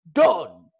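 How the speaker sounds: loud clipped shout. A narrator's reading. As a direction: slam the deal shut. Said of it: "shouted Stoic".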